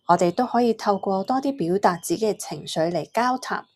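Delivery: ordinary talk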